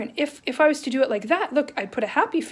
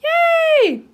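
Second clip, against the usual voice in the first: squealed yay, much higher and louder; excited cheer; 9 dB louder, much slower than usual. Pretend. Teacher acting out an excited girl for kids, high cheer. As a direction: burst with glee like a thrilled kid. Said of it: "her smile is gonna be so".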